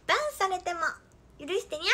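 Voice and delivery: put-on playful high-pitched cat-girl plea; cutesy cat-girl. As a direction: plead like a cute kitten. Cutesy twin-tail cat catchphrase.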